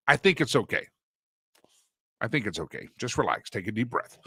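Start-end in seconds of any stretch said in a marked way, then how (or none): none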